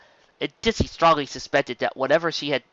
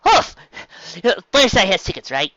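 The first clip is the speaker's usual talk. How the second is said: much higher, louder stammering; breathless unicorn lying badly; panicked stutter; 8 semitones higher, 6 dB louder than usual. A narrator's reading. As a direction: trip over every word in panic.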